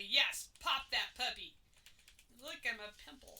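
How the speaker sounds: mock drugged euphoria. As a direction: replay your blissed-out self on the operating table. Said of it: "all I could say".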